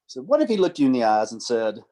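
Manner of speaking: usual speaking voice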